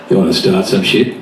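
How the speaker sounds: low-pitched tough-guy challenge; big bloke. Retold or imitated. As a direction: drop it deep like a big bloke. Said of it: "Some big bloke comes over".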